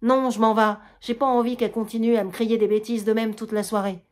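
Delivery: normal talking voice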